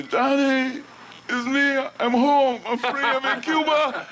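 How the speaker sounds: excited phone call. Retold or imitated. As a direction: beam it out like the first free phone call. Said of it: "And when he called me".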